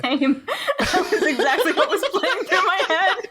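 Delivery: high laughing agreement. Real, not acted.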